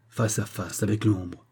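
ordinary delivery, nothing marked

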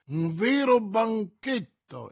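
slow, emphatic cry with stress on vero; a hint of a miser's mock delight; exclaiming. A narrator's reading. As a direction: relish every word as if it were a feast. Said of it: "esclamò Coquenard".

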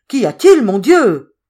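loud, high cry of alarm. A narrator's reading. The family asks Zoé what is wrong, alarmed cry. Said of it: "demandèrent d'un même cri".

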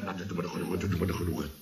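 very soft, low murmured imitation; sweet little voice. Nephew quoting aunt Lien's home remedy, murmured softly. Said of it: "met dat lieve stemmetje".

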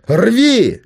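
high, loud yell of the order; shouting. A narrator's reading. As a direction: son screams the demand at his father. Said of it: "кричал сын". (крик)

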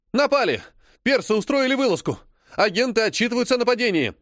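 high, fast and loud alarm; alarmed urgent report. Acted. Adjutant rushing to tell the general of the raid, alarmed. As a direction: blurt out the news of the attack in a rush.

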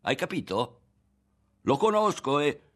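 rising, defiant outburst; heated insistence. A narrator's reading. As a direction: drive the point home loudly. Ario insists, is cut off.

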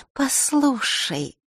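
put-on slow wheedling girlish start; coaxing girl. A narrator's reading. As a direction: draw him in sweetly. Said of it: "вкрадчиво начала Маришка".

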